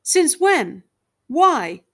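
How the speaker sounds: a loud, upset demand. A narrator's reading. Husband loudly demands why from his wife. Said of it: "His voice was loud".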